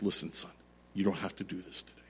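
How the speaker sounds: soft, quiet father's voice, quick; calm him, no pressure; softly reassuring a frightened son; gentle reassurance. Retold or imitated. Father gently releasing scared son.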